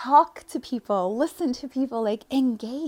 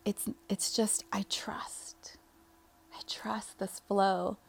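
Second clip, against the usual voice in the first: hushed whisper, slowing to a soft, sure "I trust this flow"; 7 dB quieter, slower than usual. Real, not acted. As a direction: whisper it to yourself like a private vow. Intimate self-affirmation whispered.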